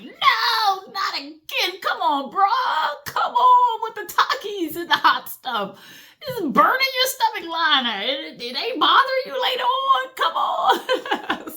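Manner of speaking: high, whiny plea, half laughing; pleading organ voice. Acted. Internal organs begging her to quit hot Takis, high and whiny. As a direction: beg like a fed-up stomach lining. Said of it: "internal organs like".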